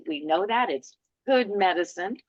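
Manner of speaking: normal talking voice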